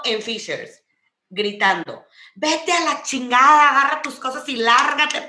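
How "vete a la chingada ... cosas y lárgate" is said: mock furious shout at his date; imitating a man yelling at his girlfriend in a bar; retold angry yell. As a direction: bark it out loud like the angry boyfriend. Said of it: "El vato le estaba gritando".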